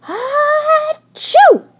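very high, loud acted sneeze; explosive sneeze. A narrator's reading. The hedgehog sneezes before Mona, explosively. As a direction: burst out with a huge sneeze. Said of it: "let out an explosive sneeze".

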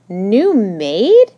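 loud, rising, puzzled question. A narrator's reading. Missus Higgins blinks at Mona, puzzled. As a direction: question it in bafflement.

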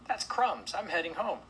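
high, loud chipmunk huff; indignant cry. A narrator's reading. Chipmunk rejecting cookies and leaving, high outcry. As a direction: announce a walkout in a high voice. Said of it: "cried the chipmunk".

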